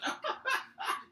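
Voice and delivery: very high staccato yelps; squeaky kobold cries. Acted. Kobold stumbles while fleeing, comic squeaky yelps. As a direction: yelp in bursts like a tumbling creature.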